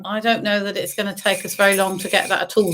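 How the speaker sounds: ordinary talk, nothing marked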